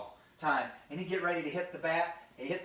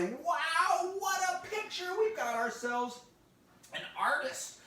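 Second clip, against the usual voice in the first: high, gushing praise; hammed-up fatherly wonder; amazed dad; 11 semitones higher, slower than usual. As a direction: exclaim it way up high, bursting with admiration. Dad admires his daughter's picture.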